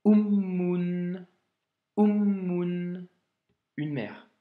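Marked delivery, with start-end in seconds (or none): 0.1-3.1 "ummun" louder, the u started by a throat catch; "ummun" with a glottal stop and a long doubled m; loud model read of "ummun", the m held long; Arabic word ummun. Shown as a demonstration.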